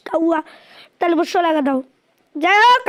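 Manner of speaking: ordinary talk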